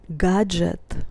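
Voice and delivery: normal talking voice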